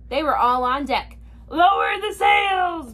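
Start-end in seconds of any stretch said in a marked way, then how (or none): 1.5-2.9 high, slow, drawn-out order; pirate shout. A narrator's reading. Captain orders crew in the storm, drawn-out shout. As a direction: shout it across the deck. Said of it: "Braidbeard shouted".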